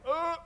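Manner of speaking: squeamish groan. Retold or imitated. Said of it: "he'd probably be in the back like".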